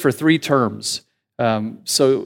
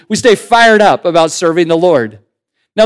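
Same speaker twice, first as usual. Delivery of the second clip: ordinary delivery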